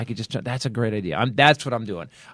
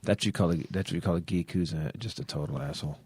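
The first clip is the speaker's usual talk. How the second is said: low mocking voice; 8 semitones lower, 10 dB quieter than usual.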